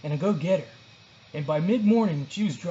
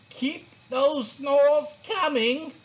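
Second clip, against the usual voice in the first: higher, slow drawling teacher voice; sleepy teacher; 9 semitones higher, 4 dB louder, much slower than usual. A narrator's reading. Teacher giving sloths a lesson, lazy drawl. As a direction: drag the words out lazily.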